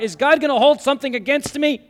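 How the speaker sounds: high, loud, urgent question; loud rhetorical question. Acted. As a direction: throw the doubt out to the hall.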